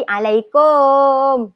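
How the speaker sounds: "alaikum" dragged out with a long final "kom"; wrong tajwid. Shown as a demonstration. Teacher showing the Malay tajwid mistake, alaikum ending overlong. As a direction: overstretch the last syllable of alaikum as "kooom". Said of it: "Salah tajwid tu".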